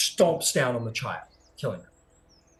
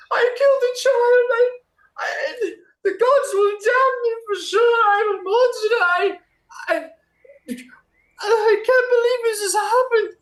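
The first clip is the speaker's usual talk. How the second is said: high, loud wailing sob; sobbing; 8 dB louder than usual. Acted. Woman sobbing to the rider over the dead child. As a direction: wail it out through tears, shrill and loud. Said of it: "sobbing, absolutely sobbing".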